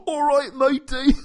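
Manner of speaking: high, loud, hammed-up British matey; mock a terrible British accent at high pitch. Co-host imitating his friend's terrible British accent. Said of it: "terrible British accent".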